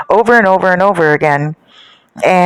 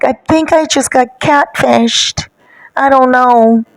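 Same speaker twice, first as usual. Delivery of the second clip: high, whiny mock crying; put-on tearful voice, pitched up; imitated tearful upset; 7 semitones higher, slower than usual. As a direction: mimic her whimpering through the words. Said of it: "Why are you crying?".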